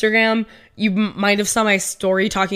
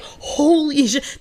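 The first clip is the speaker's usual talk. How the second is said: stunned self-talk; 5 semitones higher, much slower than usual. Retold or imitated. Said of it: "And I'm like".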